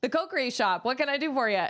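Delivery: mildly chirpy shop clerk; shopkeeper voice. Retold or imitated. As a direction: greet a customer in a bright shop voice. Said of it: "the guy's like".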